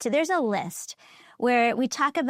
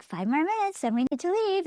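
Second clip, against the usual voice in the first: gentle prodding; 7 semitones higher than usual. Retold or imitated. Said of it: "little prodding things".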